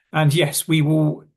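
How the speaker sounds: usual speaking voice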